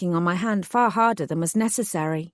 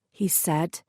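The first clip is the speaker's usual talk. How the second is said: ordinary delivery, nothing marked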